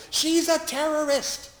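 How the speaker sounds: high, loud accusing shout; retold shout. Man in the ER shouting accusations at the chaplain, loud and high. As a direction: yell the accusation across the ER. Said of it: "pointed at my colleague and shouted".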